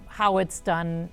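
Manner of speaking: normal talking voice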